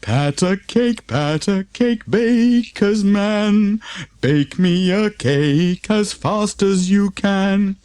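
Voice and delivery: slow, high sing-song rhyme; slow sing-song verse; reciting a verse. A narrator's reading. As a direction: recite it like a nursery rhyme for kids. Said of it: "The verse inside the card went like this".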